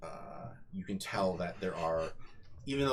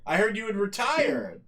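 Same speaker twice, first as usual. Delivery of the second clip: chummy host; 12 semitones higher, 5 dB louder than usual. Acted. GM voicing robot host.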